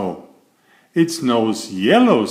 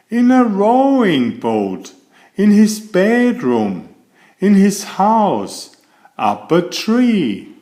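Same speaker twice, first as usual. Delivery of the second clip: higher, louder, building delight; playful reveal; 9 semitones higher, 3 dB louder than usual. A narrator's reading. Narrator delighting children with an absurd answer, higher and gleeful. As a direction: pile up each place with growing glee.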